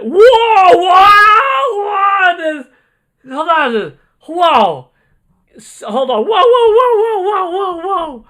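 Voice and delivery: high, loud, wordless disbelief; stunned yelling. Real, not acted. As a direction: yell it out, can't believe the number. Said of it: "what voice am I coming up with?".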